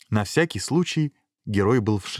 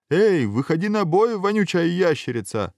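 shouted challenge; 10 semitones higher, 4 dB louder than usual. A narrator's reading. Hero calling out the stinking lizard, high shout. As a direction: holler a taunt into the cave. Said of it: "и снова крикнул".

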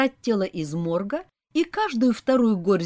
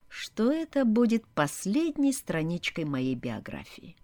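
ordinary delivery

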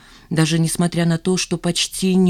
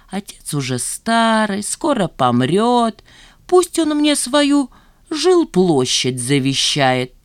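higher, very slow, drawn-out mockery; mock the daughter's supposed scheming; mocking mimicry; 5 semitones higher, 4 dB louder, slower than usual. A narrator's reading. Старик передразнивает мысли дочери. (передразнивая, нараспев)

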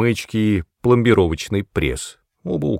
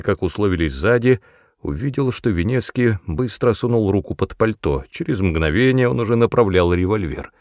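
ordinary delivery

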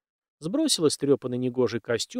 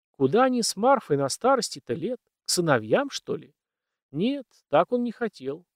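ordinary delivery, nothing marked

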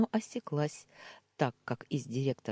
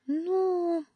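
vague drawl; 5 dB louder than usual. A narrator's reading. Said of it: "неопределённо протянула Таня".

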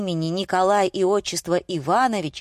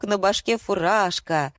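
ordinary delivery

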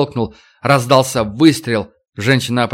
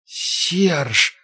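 soft, high dying groan; wounded woman's weak, higher wail; anguished moan; 4 semitones higher, 5 dB quieter than usual. A narrator's reading. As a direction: moan the name with failing strength. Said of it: "простонала она".

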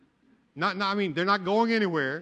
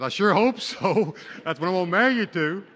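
joking retort; 4 semitones higher, 8 dB louder than usual. Retold or imitated. Joking reply to groom at altar.

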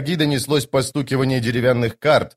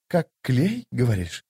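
musing question, trailing off softer; thoughtful; 4 dB quieter than usual. A narrator's reading. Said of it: "задумчиво проговорил четвёртый". (задумчиво, тихо переспрашивая)

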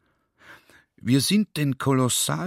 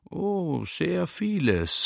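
slow, stretched and higher; drawn out; 7 semitones higher, much slower than usual. Retold or imitated. The general draws out his answer to the librarian, slowly. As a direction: drag the words out with mock nonchalance. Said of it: "sage ich gedehnt".

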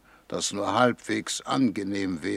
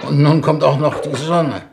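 raised voice; 4 semitones higher, 9 dB louder than usual. Acted. Rocket launch roar.

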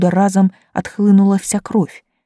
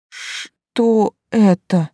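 slow, hoarse croak; хрипло; 2 semitones higher, much slower than usual. Acted. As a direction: rasp it out, barely able to speak. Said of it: "кое-как прохрипел Вадим". (еле слышный хрип от страха)

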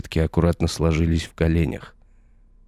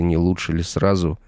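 ordinary delivery

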